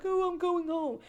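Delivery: very high, wailing imitation; hammed-up tearful wail; mock crying. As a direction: whine it like a mocked crybaby. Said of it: "I wasn't over there crying".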